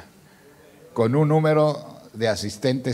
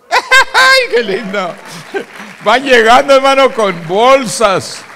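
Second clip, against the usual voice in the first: loud, high joyful outcry; exultant; 12 semitones higher, 15 dB louder, slower than usual. Real, not acted. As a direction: burst out in delight to the congregation. Exults as groceries arrive.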